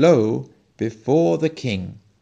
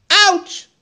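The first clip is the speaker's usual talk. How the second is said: sudden high, loud yelp of pain; cry of pain; 8 dB louder than usual. A narrator's reading. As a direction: yelp as if a thorn just pricked you. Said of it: "Huiman cried out".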